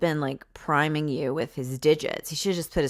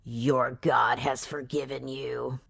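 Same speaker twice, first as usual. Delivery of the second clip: mock tender hero; 4 semitones lower, 4 dB quieter, slower than usual. Hero slow and soft.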